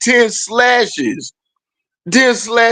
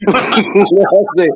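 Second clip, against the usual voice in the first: usual speaking voice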